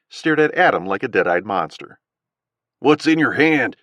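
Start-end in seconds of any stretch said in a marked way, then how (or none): 2.8-3.8 slow southern drawl, louder; thick southern accent, demanding. A narrator's reading. Gunman Owl demanding an answer in a southern accent. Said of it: "thick southern accent".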